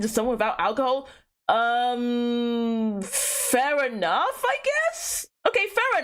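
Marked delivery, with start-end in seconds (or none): 4.4-6.0 sudden high squeak of doubt; grudging. Real, not acted. Streamer grudgingly agreeing with chat, squeaky doubt. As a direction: concede with a squeak. Reluctant concession.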